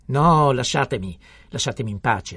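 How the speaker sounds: exasperated outburst. A narrator's reading. Homais rebuffs his wife angrily. (sfogo esasperato)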